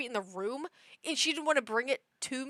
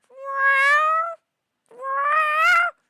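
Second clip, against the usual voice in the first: high, loud drawn-out yowl; loud cat meow; 10 dB louder than usual. Retold or imitated. Cat waking her owner with a toy, loud imitated meows. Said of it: "she's going".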